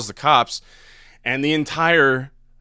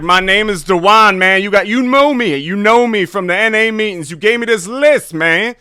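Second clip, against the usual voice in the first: much higher, loud, insistent stranger voice; prank caller Dewan; 10 semitones higher, 10 dB louder than usual. Retold or imitated. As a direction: press him like an old acquaintance. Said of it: "and I'm like".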